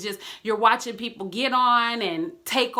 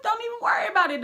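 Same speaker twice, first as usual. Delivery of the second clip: inner reassuring voice; 10 semitones higher, much faster than usual. Retold or imitated. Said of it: "that little thing in you, like".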